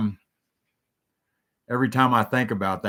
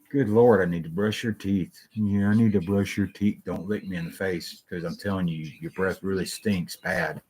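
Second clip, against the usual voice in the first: usual speaking voice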